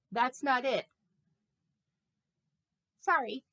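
ordinary talk

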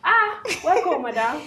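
a hammed-up shop welcome; exaggerated welcome. Receptionist greeting a customer with a stretched, high welcome.

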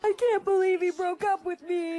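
high, distressed voice; upset lament. Acted. Girl to friend about being dumped, upset lament.

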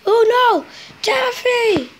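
loud, high alarmed shout; shocked shout. Acted. Workman sees the crash.

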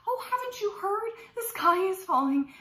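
high-pitched mock panic; alarmed storybook chicken. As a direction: break the news in high alarm. Chickens alarmed tell turkey news.

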